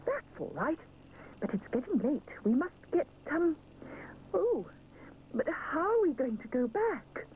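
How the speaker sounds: rising into a startled cry; sudden worry breaks in; alarmed. A narrator's reading. Missus Bear realising it's late, alarmed cry. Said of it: "cries Missus Bear alarmed".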